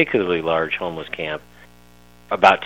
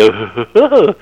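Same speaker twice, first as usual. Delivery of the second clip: high, loud dopey dog huff; hammed-up dog noise; dopey dog grunt; 9 semitones higher, 8 dB louder than usual. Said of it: "I'm imitating my dog".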